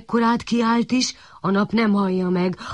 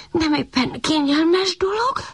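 puppy voice, high, slow and out of breath; tired puppy puffing into the can; panting; 10 semitones higher than usual. A narrator's reading. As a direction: pant the words out as you strain.

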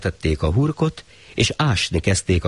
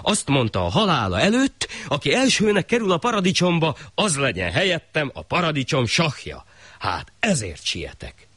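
higher, lightly voiced cunning tale; the thief fooling the shah; a hint of sly urgency; 9 semitones higher than usual.

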